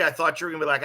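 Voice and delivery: usual speaking voice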